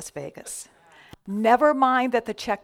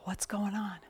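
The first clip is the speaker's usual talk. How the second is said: softer, whispered concern; gentle whisper; 10 dB quieter than usual. Retold or imitated. Minister asking the crying singer, gentle whisper. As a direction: ask it in a hushed whisper.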